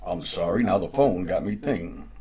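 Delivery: usual speaking voice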